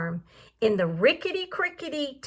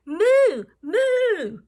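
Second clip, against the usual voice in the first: muttered moo; 6 semitones higher than usual. Acted. Voicing the baffled cow, a light mock moo. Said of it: "who muttered".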